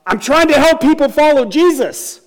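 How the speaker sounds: high, loud exasperated outburst, retold; blurting out. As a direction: burst out with it, voice way up. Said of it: "finally just blurts out".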